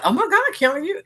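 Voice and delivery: high, loud, delighted "Oh my God"; excited surprise. Retold or imitated. Michelle and Kelly amazed at her progress, high and excited. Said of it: "she's like".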